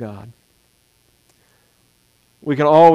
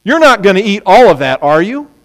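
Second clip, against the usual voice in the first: expecting no; 4 semitones higher, 5 dB louder than usual. Shown as a demonstration. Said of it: "the same tone of voice".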